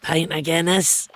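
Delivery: a hint of Edith ordering a pint; a touch of the wee old lady from Still Game. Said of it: "a sentence I'm not allowed to say because it sounds just like her".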